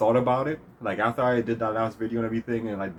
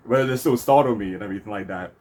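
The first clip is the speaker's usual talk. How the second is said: usual speaking voice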